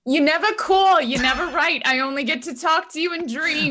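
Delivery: high-pitched mock guilt trip; nagging mother. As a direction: complain like a neglected mother. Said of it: "You're like".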